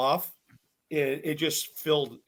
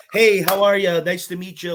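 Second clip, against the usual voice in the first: loud, cheery imitation greeting; mock meet-and-greet voice; mock friendly greeting; 3 semitones higher, 7 dB louder than usual. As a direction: greet them loudly like new acquaintances. Voicing a greeting that never happened.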